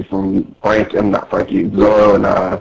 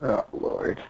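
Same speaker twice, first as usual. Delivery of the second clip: ordinary talk, nothing marked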